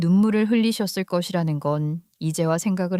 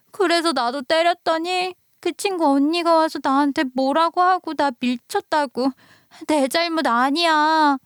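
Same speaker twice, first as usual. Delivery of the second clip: put-on high, resentful little girl complaining; upset child; 7 semitones higher than usual. Daughter complains to her mother about school, high and resentful. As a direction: blurt out the unfairness.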